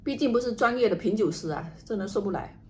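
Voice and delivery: ordinary talk, nothing marked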